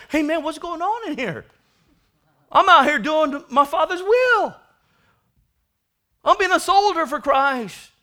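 high, loud complaining brother; a hint of a resentful older brother; indignant older son. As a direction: protest like a wronged son.

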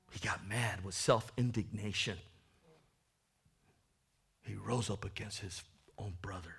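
dramatic preacher whisper. Real, not acted. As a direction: lean in and whisper it. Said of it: "whispered line".

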